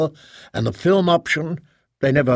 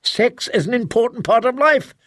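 much higher, defensive protest; 9 semitones higher than usual. A narrator's reading. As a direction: justify yourself, stung. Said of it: "Cantling said defensively".

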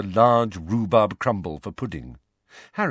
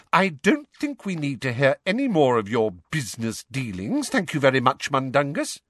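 higher, sharply disapproving mother; 6 semitones higher than usual. A narrator's reading. Mother shutting down talk of business dealings, sharply. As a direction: cut the storyteller off sharply. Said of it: "said Missus Weasley sharply".

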